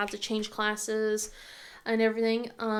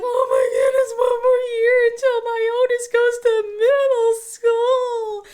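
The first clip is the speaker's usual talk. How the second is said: much higher, louder, crying through the words; 12 semitones higher, 11 dB louder than usual. Real, not acted. Mother realizing her oldest leaves soon, real crying. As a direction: voice breaking into real tears. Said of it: "I've been trying not to cry".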